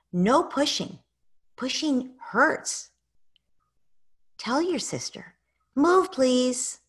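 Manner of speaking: firm gentle limit. Shown as a demonstration. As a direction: set the limit kindly but clearly. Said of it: "when you need to correct your child".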